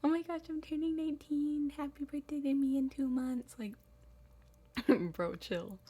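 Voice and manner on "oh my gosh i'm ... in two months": high, gushing birthday brag voice; hammed-up giddy self-parody; mock excited. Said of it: "I don't need to be like".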